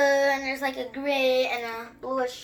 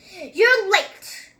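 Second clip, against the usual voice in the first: higher, louder scolding call; mother scolding her late sons, loud; mother's exclamation; 7 semitones higher, 7 dB louder than usual. A narrator's reading. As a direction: snap it out like a cross mother. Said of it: "exclaimed their mother".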